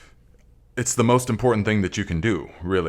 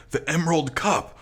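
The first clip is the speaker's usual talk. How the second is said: imagined own thought, higher; 8 semitones higher than usual. Retold or imitated. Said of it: "I was probably like".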